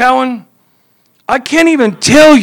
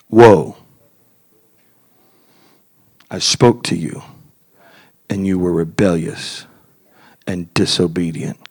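much lower, much softer, grave voice; solemn rebuke from God; 9 dB quieter than usual. Acted. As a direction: speak as God disappointed.

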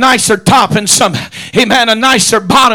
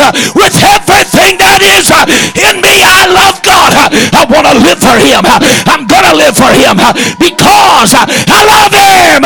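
higher and louder, building to a shouted peak; emphatic climax; 7 semitones higher, 4 dB louder than usual. Real, not acted. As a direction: pour everything into the last cry of love. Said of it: "you don't have to be so emphatic".

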